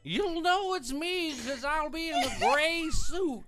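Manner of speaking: high, slow baseball announcer parody; drawn-out, high-pitched commentator impression; Harry Carey. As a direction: drag it out in a hammed-up sportscaster voice. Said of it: "I'm Harry Carey".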